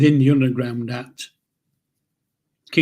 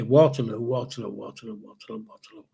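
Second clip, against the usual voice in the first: each Waterloo quieter until it vanishes; fading echo; 3 semitones lower, 4 dB quieter than usual. Retold or imitated. The London stranger's directions dying away into a fading echo. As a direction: let the name trail off like a distant echo.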